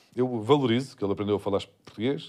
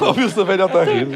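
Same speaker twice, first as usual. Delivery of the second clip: louder, laughing question; amused laughing; 5 semitones higher, 5 dB louder than usual. Real, not acted. Joking to co-hosts about the old lady, laughing. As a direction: say it through real laughter.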